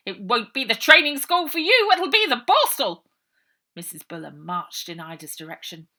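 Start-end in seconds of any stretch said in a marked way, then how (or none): 0.1-3.0 loud, high furious threat; angry matron. A narrator's reading. Matron threatening the trespassing girl, loud and furious.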